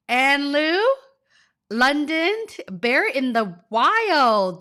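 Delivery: bubbly shout-outs to the chat, name after name; overjoyed host greeting her viewers; excited roll call. Real, not acted. Excitedly greeting live chat names.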